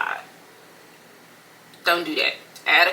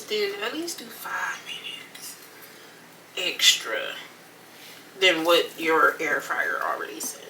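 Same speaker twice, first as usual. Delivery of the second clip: whispered tip, slower; whispering. Real, not acted. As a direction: hush it like a secret. Whispered advice.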